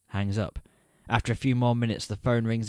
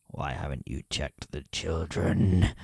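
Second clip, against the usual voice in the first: lower, slow, menacing phone voice; creepy caller; 5 semitones lower, slower than usual. Acted. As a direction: let it crawl out slow and threatening. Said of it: "the voice says".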